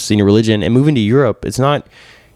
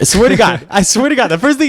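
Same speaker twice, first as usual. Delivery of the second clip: excited disbelief; 9 semitones higher than usual. Real, not acted. Excited retelling, pitch up.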